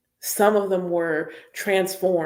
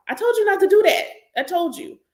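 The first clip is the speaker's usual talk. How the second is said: scolding mother; 12 semitones higher, 4 dB louder, faster than usual. Acted. Mother scolding after burn.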